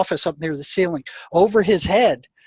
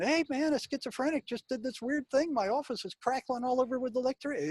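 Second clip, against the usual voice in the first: mock pleading to the boss; 7 semitones higher, 11 dB quieter than usual. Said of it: "I couldn't go to my boss".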